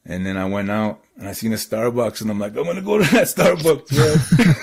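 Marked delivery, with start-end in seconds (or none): 2.6-4.5 high, loud retold resolve; mock urgency. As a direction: blurt it out as a hurried escape plan. Acts out his alarmed decision.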